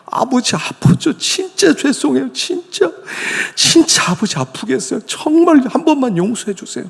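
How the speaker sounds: high, pleading, near-tearful apology; pleading cry. Retold or imitated. Son pleading with his hurting father, high near-tearful cry.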